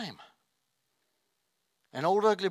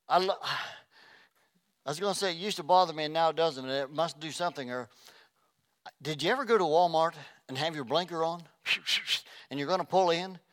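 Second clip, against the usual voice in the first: ordinary talk, nothing marked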